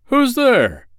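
loud, high call into the dark; shout. Acted. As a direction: shout out into the darkness. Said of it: "he shouted out of the darkness".

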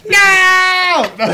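high shouted no. Real, not acted. Podcast friend objects to the news, high shout. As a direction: shout it out in protest.

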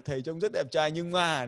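1 mock backhanded compliment. Shown as a demonstration. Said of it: "thì cũng phải chết".

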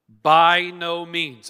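slow, loud, every word hammered; emphatic denial. Real, not acted. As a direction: plant your feet and refuse it slowly.

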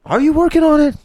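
high teasing squeak, jumping way up; mock squeaky voice. Real, not acted. Teasing his co-host in a high squeak. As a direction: squeak the question to tease him.

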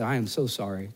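low, soft sorry to his wife; soft apology. Retold or imitated. Husband apologizing softly to his wife. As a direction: apologize gently, almost under your breath. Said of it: "I said".

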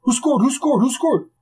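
high, quick excited questions; excited rapid-fire. Retold or imitated. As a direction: fire it off like an eager coach. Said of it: "Scotty gets excited. He talks a mile a minute".

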